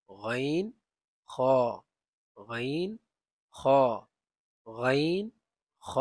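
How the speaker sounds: গাইন and খ rasped from the top of the throat; upper throat letters. Shown as a demonstration. Teacher drilling খ and গাইন for the class, loud. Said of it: "এই দুটি অক্ষর এক স্থান থেকে উচ্চারিত হয়".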